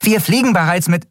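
high-pitched, pressured report; urgent protest. A narrator's reading. Engineer protests to her captain over the comm, urgent. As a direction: insist until cut off.

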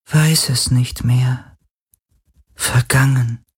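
drawn-out, faint otherworldly voice; weak spirit voice. A narrator's reading. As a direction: answer as a fading being who cannot remember.

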